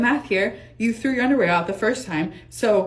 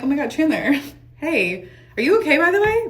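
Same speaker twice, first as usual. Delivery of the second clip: mock concern; 6 semitones higher, 4 dB louder than usual. Greeting friend, mock concern.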